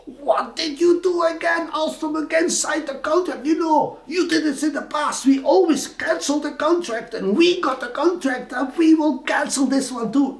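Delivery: mock outraged consul shouting. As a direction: rant at him like the irate German consul. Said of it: "shouting to me in German".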